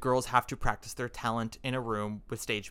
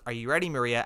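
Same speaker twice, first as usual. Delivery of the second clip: ordinary talk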